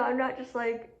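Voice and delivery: ordinary delivery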